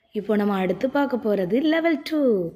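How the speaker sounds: normal talking voice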